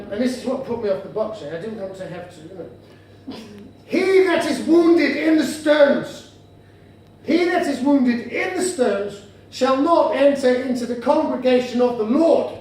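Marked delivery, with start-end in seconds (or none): 3.8-12.5 slow, solemn Bible-reading delivery; scripture reading. Acted. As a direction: intone it like a verse from the pulpit, spaced out. Reads a Bible verse aloud.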